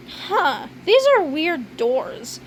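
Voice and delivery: usual speaking voice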